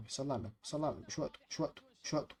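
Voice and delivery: soft, low muttering; hushed self-restraint. Real, not acted. Gamer telling himself now is not the time, hushed self-restraint. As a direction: mutter it under your breath.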